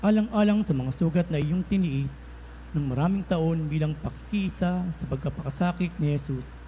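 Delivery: ordinary talk, nothing marked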